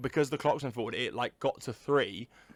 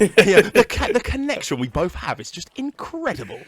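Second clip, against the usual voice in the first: mock gushing; 7 semitones higher, 8 dB louder than usual. Mock-grand praise of friendship.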